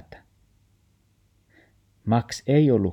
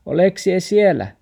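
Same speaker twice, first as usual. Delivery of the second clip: higher, louder worried question; grandma calling out, high and loud; calling into bush; 11 semitones higher, 7 dB louder than usual. Acted. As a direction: call into the bush hoping he's there. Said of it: "murfaari kysyy".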